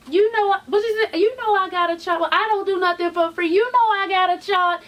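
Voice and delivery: high-pitched sassy imitation; mimicked sass. Vlogger mimicking a star's lines, high and sassy. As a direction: push the pitch up, mock-insistent.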